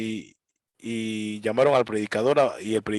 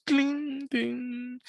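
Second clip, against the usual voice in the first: very high soft plinks; piano plink imitation; 12 semitones higher, 6 dB quieter than usual. Mocking the piano behind preachers, high tinkling. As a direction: chime like a piano key.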